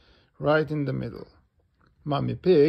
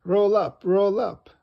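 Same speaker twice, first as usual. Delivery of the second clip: loud, high fairground call; stallholder's raised cry; 7 semitones higher, 8 dB louder than usual. A narrator's reading. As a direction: call out to draw a crowd. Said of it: "cries Miss Rabbit".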